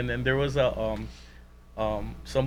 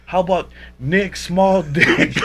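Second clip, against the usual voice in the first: teasing Sonny; 6 semitones higher, 8 dB louder, slower than usual. Retold or imitated.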